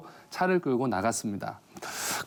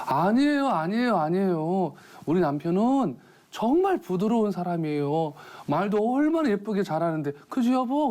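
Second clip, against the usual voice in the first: higher, coaxing wife's voice; sugary defence of her husband; wife sweetly covering for her husband, higher voice; 7 semitones higher than usual. Retold or imitated. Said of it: "이 부인이 한마디 합니다".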